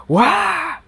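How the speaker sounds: high, drawn-out cry of dismay; alarmed cry. Acted. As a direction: cry out high at the new plague. Outcry at the snake plague.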